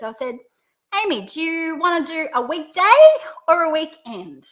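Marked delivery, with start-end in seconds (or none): 1.0-2.8 "weekday" rising high at the end; pitch up on first option. Shown as a demonstration. Consultant offers Amy a weekday, pitch going up. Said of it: "listen to the pitch in my voice". 3.5-4.5 "weekend" falling to a close; pitch down on second option. Shown as a demonstration. Consultant offers Amy the alternative, pitch going down. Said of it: "Are you hearing the pitch?".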